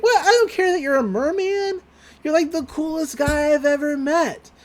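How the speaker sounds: put-on high-pitched gushing girl voice; Mabel's character voice. Smitten girl to the merman, high gushing voice.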